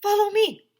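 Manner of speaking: high, loud urgent order; urgent command. A narrator's reading. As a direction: lead them on urgently. Principal leads urgently.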